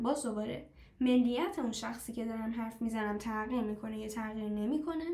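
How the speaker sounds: ordinary talk, nothing marked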